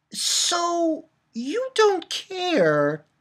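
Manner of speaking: very slow, high indignant question; indignant drawl. Retold or imitated. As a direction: drag it out in disbelief. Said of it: "I'm like".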